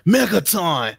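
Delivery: hyped-up "megaton" headline voice; mock clickbait headline. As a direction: announce it like an overhyped video title. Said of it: "change the title".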